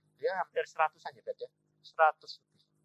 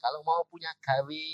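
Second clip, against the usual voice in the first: ordinary talk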